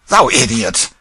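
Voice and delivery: loud, contemptuous jab; scornful insult. Acted. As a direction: spit it at the boy. Hugo insults the king.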